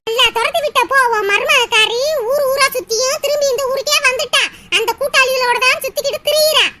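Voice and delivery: ordinary delivery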